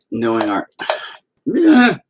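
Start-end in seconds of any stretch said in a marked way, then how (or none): 1.5-2.0 exasperated sigh. Real, not acted. Exasperated sigh about internet.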